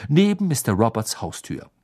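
usual speaking voice

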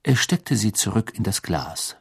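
usual speaking voice

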